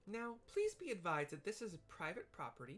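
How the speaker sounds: high, prim and a little indignant; high proper little receptionist; prim startled Lalafell. Acted. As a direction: scold the sneak politely in a high voice. Voicing Tataru startled and prim.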